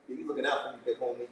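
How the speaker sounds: ordinary delivery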